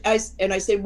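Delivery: ordinary delivery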